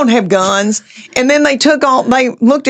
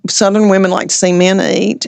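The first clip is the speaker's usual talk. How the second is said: ordinary delivery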